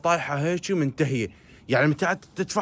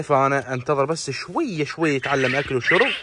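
usual speaking voice

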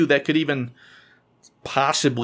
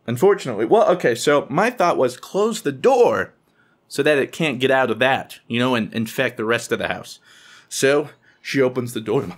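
ordinary talk, nothing marked

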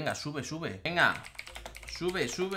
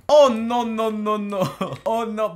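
horror fingido; 6 semitones higher, 10 dB louder, slower than usual. Acted. Finge horror en la competición.

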